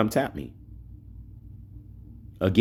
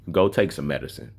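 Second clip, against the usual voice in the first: usual speaking voice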